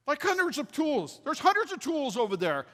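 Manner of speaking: incredulous, excited high voice; amazed. Retold or imitated. Amazed at the wall of tools.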